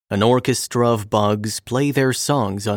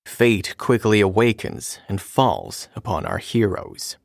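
usual speaking voice

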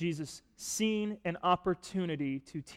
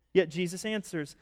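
ordinary talk

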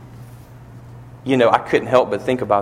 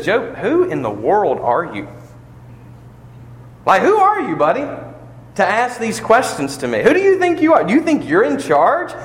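stern scolding father; 10 semitones higher than usual. Acted. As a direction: put him in his place like a scolding parent. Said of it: "I sound like talking to my kids".

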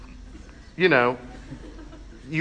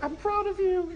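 tiny, very high mock-tearful squeak; choked-up pride; 4 dB quieter than usual. Retold choked-up words to child.

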